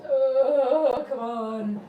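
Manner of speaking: loud exasperated groan at the cat. Real, not acted. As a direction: let it out, fed up. Frustrated at the cat.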